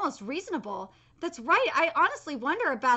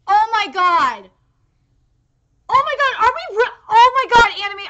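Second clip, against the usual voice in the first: loud, high, real shout of disbelief; real exasperated shouting; outraged yelling; 4 semitones higher, 9 dB louder than usual. Real, not acted. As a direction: yell at the anime in outrage. Outraged at anime skipping scene.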